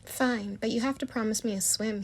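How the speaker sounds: ordinary delivery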